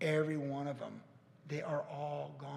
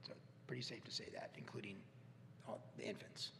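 whispered, much softer and quicker; whispered aside; 6 semitones lower, 16 dB quieter than usual. Acted. Pastor mutters the grim truth about infants in a whisper. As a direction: drop to a hushed aside. Aside under his breath.

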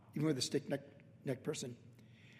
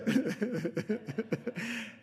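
light laugh; 6 semitones higher than usual. Real, not acted. Laughing at how it worked.

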